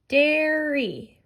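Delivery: teacher stretching "Dairy" so the long A stands out; stretched long A. Shown as a demonstration. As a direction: draw out the air in "Dairy". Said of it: "I hear the long A".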